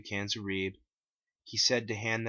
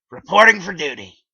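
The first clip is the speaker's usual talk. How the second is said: Liquid Chris voice; 9 semitones higher, 19 dB louder than usual. A narrator's reading. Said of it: "he said".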